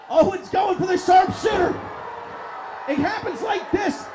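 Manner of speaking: loud, high, excited ringside call; live play-by-play. Acted. Commentator calling a video game tag match to viewers, shouting. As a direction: call the match at full throat.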